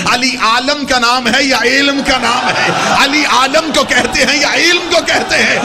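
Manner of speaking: high, driving sermon declamation; fiery preaching. Acted. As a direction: preach at full force to a roaring crowd. Preacher declaiming at full force. (پرجوش خطیبانہ انداز)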